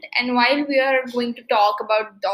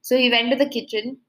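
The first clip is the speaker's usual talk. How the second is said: ordinary talk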